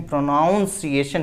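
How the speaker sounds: प्रोनाउंसिएशन with "nown" in the second syllable; mispronunciation. Shown as a demonstration. Teacher warning students what they cannot say, mispronunciation. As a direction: show the faulty pro-nown-see-ay-shun in प्रोनाउंसिएशन. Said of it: "नहीं बोल सकते".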